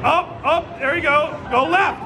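high, urgent called-out directions; calling out directions. Real, not acted. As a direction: shout each direction to the player. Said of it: "I'm gonna call out the direction".

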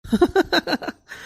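high, drawn-out cackle; mocking laugh. Boss to fawning employee, mocking high laugh. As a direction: laugh off the flattery in a high staccato.

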